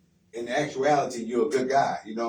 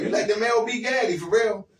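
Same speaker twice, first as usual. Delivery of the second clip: laughing; 8 semitones higher, 4 dB louder than usual. Real, not acted. Teasing his friend, laughing.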